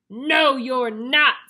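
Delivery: very loud, scary troll. Acted. Troll refuses the little goat, bellowing. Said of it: "said the troll".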